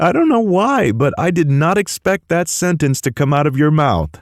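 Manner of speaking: high amused voice, still laughing; laughing disbelief. Acted. Dylan laughing at Miles's blunt line. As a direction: get it out through the laugh.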